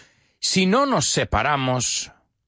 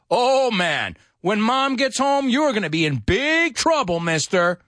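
high-pitched mock scolding; teasing warning; 9 semitones higher than usual. Warning someone about Mom coming home, mock scolding. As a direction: stretch "big" as a playful threat.

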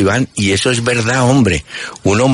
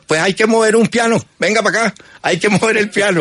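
mock sergeant; 11 semitones higher than usual.

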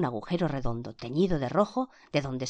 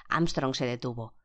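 ordinary delivery

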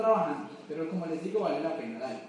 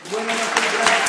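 shouted thanks over the crowd; loud thank-you to the audience; loud goodbye; 3 semitones higher, 14 dB louder, slower than usual. Real, not acted. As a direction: call out the goodbye to the whole room. Sign-off over applause.

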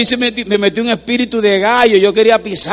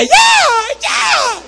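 retold shouting. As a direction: shout it like the lady interrupting. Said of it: "la señora me decía".

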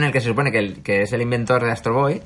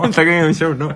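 laughing; 5 semitones higher than usual. Real, not acted. Echoes the joke, laughing.

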